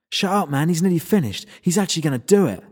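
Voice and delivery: put-on a keyed-up young guy telling him to shut up; excited human. Human snaps at the vampire.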